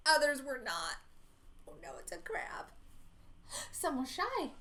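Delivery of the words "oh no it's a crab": low, soft whispered warning; hushed lower alarm. A narrator's reading. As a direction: whisper it like danger. Narrator warns listener.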